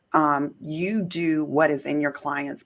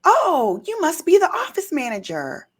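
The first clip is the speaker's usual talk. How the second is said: presumptuous lady; 11 semitones higher, 5 dB louder than usual. Retold or imitated. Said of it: "this lady had the nerve to say".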